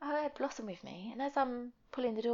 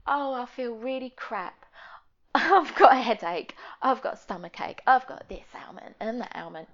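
louder, whiny list of aches; mock moaning; 3 semitones higher, 11 dB louder than usual. Moaning to an acquaintance, hammed-up whine. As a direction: ham up the complaining.

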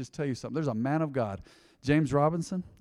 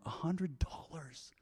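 slow, hushed whisper of disbelief; amazed whisper; 3 semitones higher, 11 dB quieter, much slower than usual. Real, not acted. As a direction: whisper it slowly, marvelling. Marvelling at the tiny price.

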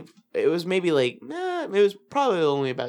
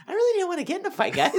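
mock innocent protest; 13 semitones higher, faster than usual. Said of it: "I was just like".

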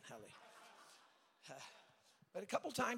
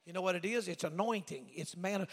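normal talking voice

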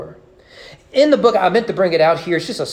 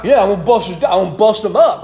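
put-on tough kid voice; 2 semitones higher than usual. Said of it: "he keeps going this scenario".

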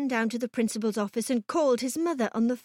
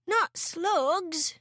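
higher-pitched storybook protest; boy's dismayed cry; 7 semitones higher than usual. A narrator's reading. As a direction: cry out in mild horror. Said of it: "George cried out".

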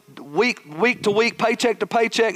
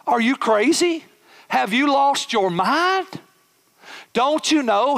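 higher, exasperated thoughts, mock disbelief; incredulous inner voice; 6 semitones higher, slower than usual. Pastor mocking Brother Curtis's advice, exasperated inner disbelief. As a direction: scoff inwardly at the praise. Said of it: "I thought in the back of my head".

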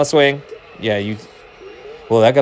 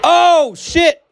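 high, loud burst of joy; real excited shout; 4 dB louder than usual. Real, not acted. Streamer cheering a huge hit, loud high burst. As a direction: explode with delight at the hit. Big play for his team.

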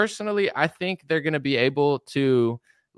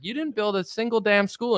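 ordinary delivery

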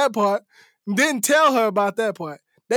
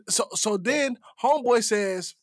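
ordinary delivery

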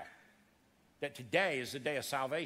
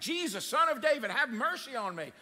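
shouting for mercy; 7 semitones higher, 4 dB louder than usual. Acted. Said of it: "he started shouting".

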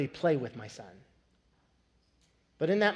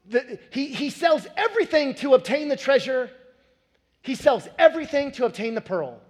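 higher, louder sermon build; impassioned preaching; 9 semitones higher, 7 dB louder than usual. Acted. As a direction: drive the point home with fervor. Preaching climax.

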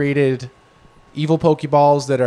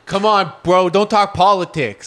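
coworker; 5 semitones higher, 5 dB louder than usual. Retold or imitated. Coworker voiced in break room.

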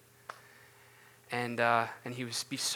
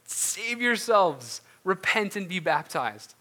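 high, loud, very slow plea; pleading Peter; 6 semitones higher, 6 dB louder, slower than usual. Retold or imitated. Peter pleading with the crowd, high and loud. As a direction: beg the crowd to save themselves. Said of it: "He's pleading with them, being like".

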